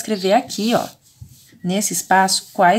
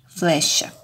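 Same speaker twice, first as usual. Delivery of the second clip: ordinary talk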